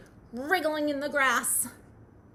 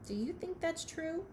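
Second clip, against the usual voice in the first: usual speaking voice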